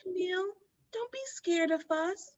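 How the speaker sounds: put-on creepy child. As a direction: lure them in like a friendly ghost child. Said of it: "The ghost is like".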